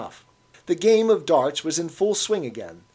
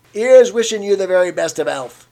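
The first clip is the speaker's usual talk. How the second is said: louder, higher toast; hearty old Cockney toast; 6 semitones higher, 11 dB louder than usual. A narrator's reading. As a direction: raise the glass to Winston. Old man toasting Winston.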